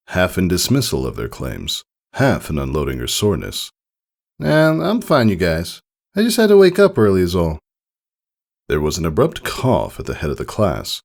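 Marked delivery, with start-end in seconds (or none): 4.4-7.6 high, quick brush-off; dismissive filly. Acted. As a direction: wave off their worry, a bit hurried. Scootaloo brushing off concern.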